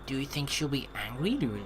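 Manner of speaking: ordinary talk, nothing marked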